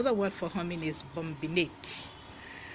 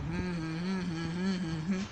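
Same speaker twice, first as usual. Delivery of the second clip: humming. Shown as a demonstration. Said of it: "what it is to hum".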